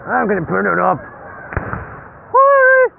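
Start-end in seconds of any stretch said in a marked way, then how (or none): 2.3-2.9 high thrilled squeal at the fireworks; excited whee. Real, not acted. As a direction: let it fly up high, delighted. Cheering at fireworks.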